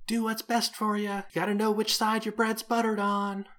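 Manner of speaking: put-on high, loud child's street-hawker call; high-pitched kid hollering to passers-by; shouting kid. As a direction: shout it out like a kid handing out leaflets. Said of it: "like, a kid's voice shouting".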